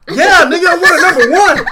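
snapping the order out again, fed up. Retold or imitated. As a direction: customer at a drive-thru window, losing patience. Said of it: "bro, I was hot".

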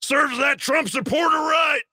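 high, slow hammed-up shout of a smug elitist; mock shout. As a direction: belt it out high and slow as a mocking caricature. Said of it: "mocking the author".

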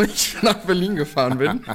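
laughing through the words. Real, not acted. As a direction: giggle as you confess. Laughs at own secret.

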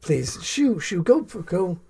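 put-on theatrical villainess shooing children off; slow disapproval, then airy shooing; would-be stepmother, dismissive. Villainess shoos children away after attack on mercenary, dismissively.

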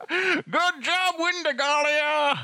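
high, cackling character voice; Kona cackling. Acted. Game master as Kona taunting Wendy, cackling high. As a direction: crow it high, drawing out the name. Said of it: "Kona cackles and goes".

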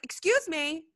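a diva-ish God taking offence; indignant God. Retold or imitated. God put out at Moses, high and sassy. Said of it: "God's like".

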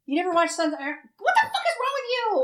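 high, fast, laughing outrage; teasing a friend who never watched the show; laughing disbelief. Real, not acted. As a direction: scoff at the friend, can't believe it.

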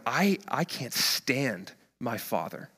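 quiet, slow confession under the breath; muttered resentment. Acted. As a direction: let it out softly and haltingly, as if to himself. Son's secret resentment, voiced by preacher.

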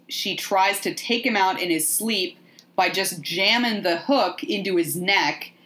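normal talking voice

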